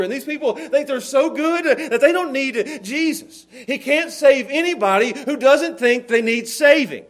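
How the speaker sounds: loud, heated sermon delivery; impassioned preaching. Real, not acted. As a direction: drive the point home loudly to the congregation. Preacher building to heated rebuke.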